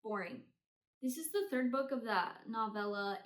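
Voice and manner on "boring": ordinary talk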